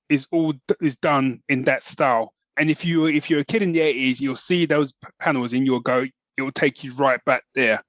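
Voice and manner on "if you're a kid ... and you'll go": normal talking voice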